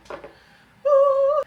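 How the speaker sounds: high whoop to shake off nerves; excited whoop. Real, not acted. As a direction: cheer herself up. Psyching herself up.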